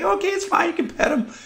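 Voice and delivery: high, soft, sweet falsetto lady voice; mimicking the lady. Imitating the dog owner in the airport letting the kid pet, high and soft. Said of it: "the lady's like".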